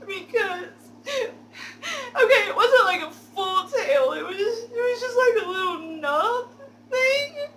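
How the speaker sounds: high, loud fake sobbing with laughter breaking through; fake crying through laughs. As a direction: ham up the fake tears, barely holding a laugh. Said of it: "fake tears that I can create".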